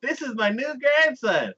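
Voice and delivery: high, gushing proud grandpa; doting grandpa. Retold or imitated. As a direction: brag about the grandson, beaming. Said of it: "and he's like".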